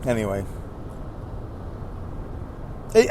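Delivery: usual speaking voice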